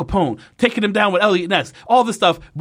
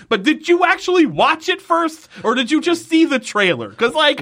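high exasperated questions; indignant challenge; 10 semitones higher than usual. Real, not acted. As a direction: needle the writers in disbelief. Ranting at the writers.

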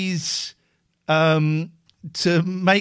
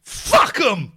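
defiant outburst; 8 semitones higher, 4 dB louder than usual. Real, not acted. Defiant outburst about Patreon.

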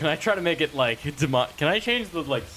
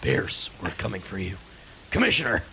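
Batman voice; 6 semitones lower than usual. Pretend.

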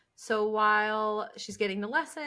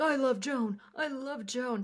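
slightly higher, lightly mocking declaration; mock lovesick John; 4 semitones higher than usual. Co-host imitating John gushing over Joan, lightly mocking. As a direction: lightly imitate a smitten man. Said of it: "when he's like".

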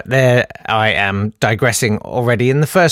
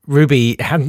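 ordinary delivery

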